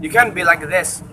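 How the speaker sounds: ordinary talk, nothing marked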